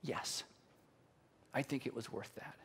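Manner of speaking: low, very soft assurance; Jesus. Acted. Jesus answering that the pain was worth it, hushed. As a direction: answer gently, almost under the breath. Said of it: "And Jesus would say".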